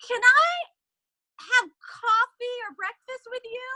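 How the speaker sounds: high, loud, slow asking; a nervous young man begging a famous host; eager plea. Retold or imitated. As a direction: blurt out the big request. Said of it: "he says".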